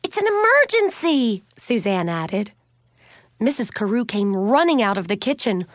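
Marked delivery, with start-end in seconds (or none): 0.0-1.4 high, loud plea for help; urgent shouting. A narrator's reading. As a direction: call out like it's an emergency. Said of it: "Katie shouted".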